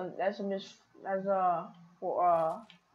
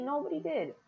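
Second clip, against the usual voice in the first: voice crack; 7 semitones higher, 5 dB quieter than usual. Real, not acted. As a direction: voice cracking up high. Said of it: "one of my hugest voice cracks".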